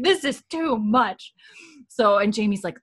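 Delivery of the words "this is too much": slow, higher exasperated line; lightly hammed exasperation; fed-up Jordy. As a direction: sigh it out like a scandalized prude. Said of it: "It's like".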